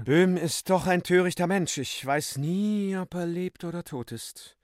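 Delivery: Euphemia. Acted. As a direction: speak as the affected lady.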